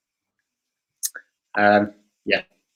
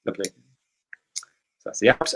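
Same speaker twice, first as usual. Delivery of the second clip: whispered aside; 3 semitones lower, 8 dB quieter than usual. Real, not acted. Quiz host winding down.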